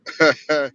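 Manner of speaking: a sudden burst of loud laughter. Real, not acted. Laughing loudly after his friend teases him about his voice. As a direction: let out a big real laugh.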